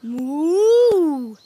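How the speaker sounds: long, swelling cow moo. Pretend. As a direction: moo like a cow, rising then falling. Animal sounds after matching game.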